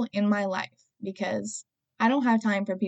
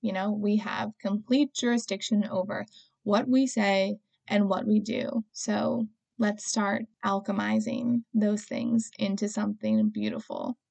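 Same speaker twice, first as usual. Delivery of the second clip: ordinary talk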